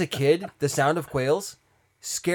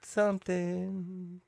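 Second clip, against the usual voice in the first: drawn out; 9 semitones higher, 5 dB louder, much slower than usual. Pretend. Drawn out silly word.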